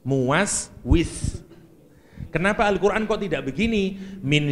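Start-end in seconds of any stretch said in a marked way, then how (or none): 0.0-1.4 Muwaswis slow and careful, each syllable spelled out in Arabic; Arabic pronunciation. Shown as a demonstration. Preacher giving the congregation the Arabic word Muwaswis, carefully pronounced. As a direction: draw out Muwaswis slowly, like a recitation teacher. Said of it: "bahasa Arabnya muwaswis".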